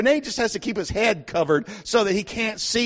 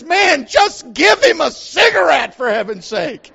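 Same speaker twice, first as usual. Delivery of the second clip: exasperated patient; 5 semitones higher, 6 dB louder, slower than usual. Retold or imitated. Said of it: "the guy that's on the gurney says".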